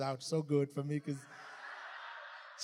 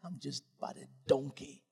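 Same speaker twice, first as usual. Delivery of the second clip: softer, low murmured prayer; hushed humble prayer; 5 semitones lower, 5 dB quieter than usual. Retold or imitated. He recalls praying this morning before preaching, hushed and humble. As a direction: pray quietly with humility. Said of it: "Today, I said that".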